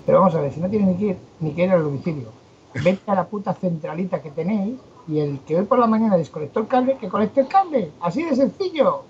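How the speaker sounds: retold exasperated rant, climbing high in pitch; bad-tempered outburst. Host retelling his row with the phone technician, fed up. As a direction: re-enact telling him off, rising in exasperation. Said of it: "perdonad este arranque de mala leche".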